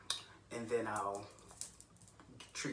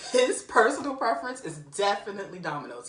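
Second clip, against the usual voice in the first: emphatic; 7 semitones higher, 12 dB louder than usual. Real, not acted. Emphatic claim about friend.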